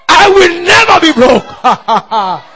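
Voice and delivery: high, drawn-out defiant shout; shouting it back. Shown as a demonstration. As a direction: shout it back slowly at full voice. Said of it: "You shout it back at him".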